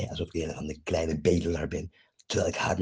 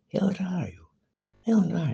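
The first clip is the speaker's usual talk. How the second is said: mocking high voice; 11 semitones higher, slower than usual. Real, not acted. Spot met hoge stem.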